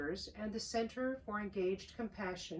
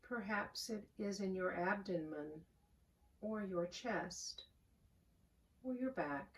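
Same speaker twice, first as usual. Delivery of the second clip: calm, unhurried voice with long pauses; soothing prayer-meditation delivery, phrase by phrase; guided meditation; 4 dB quieter than usual. Real, not acted. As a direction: guide them gently into the breath, softening as you go. Leads a guided breathing meditation.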